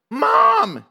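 high, loud cry for mom; weak yell. Retold or imitated. Girl yelling from her sickbed for her mother, high and loud. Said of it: "she yells in her weakened".

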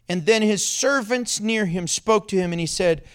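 ordinary talk, nothing marked

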